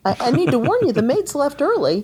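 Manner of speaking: high-pitched mock helpful warning; light, high imitation of an eager adviser; helpful tipster. Voicing the visitor's helpful warning.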